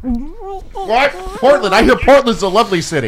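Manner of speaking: high, exaggerated surprised What; mock surprise. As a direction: ham up the shock, pitched high.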